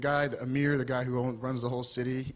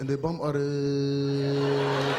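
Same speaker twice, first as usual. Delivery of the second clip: put-on Arabic accent; 3 semitones lower, slower than usual. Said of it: "Middle Eastern people always start each sentence with".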